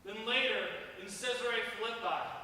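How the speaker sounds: usual speaking voice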